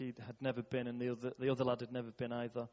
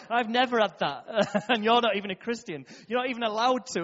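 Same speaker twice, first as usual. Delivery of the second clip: real laughter running through the words, higher and louder; laughing; 6 semitones higher, 7 dB louder than usual. Real, not acted. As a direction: laugh your way through the disbelief. Laughing at surprising testimony.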